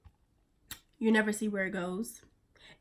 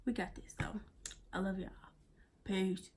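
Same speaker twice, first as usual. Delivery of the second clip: whisper; 8 dB quieter than usual. Real, not acted. Whispered sign-off.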